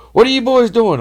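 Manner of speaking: high loud mock cop bark; cop yelling. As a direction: catch the boys red-handed. Cop catching kids with flashlights.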